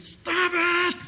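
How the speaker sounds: very high, loud drawn-out shout; yelled and screamed. Acted. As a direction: scream an order at passers-by. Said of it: "they just yelled and screamed".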